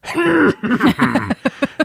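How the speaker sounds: high, sputtering nervous whimper; nervous throat noise. Retold or imitated. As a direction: sputter anxiously while trying to be cool. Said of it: "trying to be cool and I'm going".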